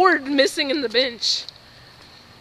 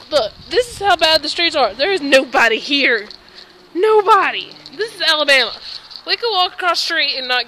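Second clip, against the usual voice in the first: excited; 4 semitones higher, 7 dB louder than usual. Real, not acted. Loud rant about Alabama.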